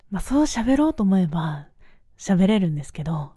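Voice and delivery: lightly put-on smooth announcer voice; a hint of a polished radio host; nice radio voice. Shown as a demonstration. Showing off a lightly done radio voice to listeners. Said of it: "ちょっといい声で".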